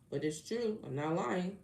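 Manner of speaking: usual speaking voice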